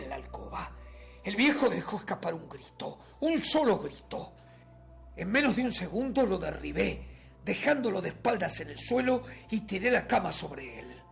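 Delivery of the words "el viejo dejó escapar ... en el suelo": raised, louder attack on the words; urgent, high-pitched dramatic narration; violent urgency. A narrator's reading. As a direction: tell the assault in a forceful rush. Narrator recounts the sudden attack.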